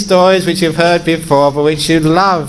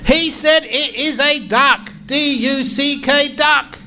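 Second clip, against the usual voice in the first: exasperated husband; 13 semitones higher than usual. Acted. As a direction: spell it out in a strained, high squabbling voice. Acted husband at peak of squabble.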